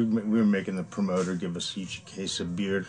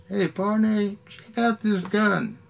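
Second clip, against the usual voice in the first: much higher, slow put-on call; Jimmy; 11 semitones higher, slower than usual. Tour manager showing a gun to a friend, high mock excitement. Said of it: "Jimmy, the tour manager, goes".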